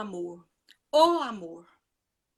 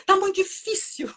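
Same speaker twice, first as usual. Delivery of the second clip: tearful, pitched up and cracking; real tears on the edge of the words; voice breaking; 7 semitones higher than usual. Real, not acted. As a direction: let the pain crack through. Painful moment, voice breaking.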